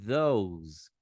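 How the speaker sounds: "those" said low, with a voiced th; low, careful "those"; teacher repeating "those"; English pronunciation. Shown as a demonstration. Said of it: "ficaria mais ou menos assim".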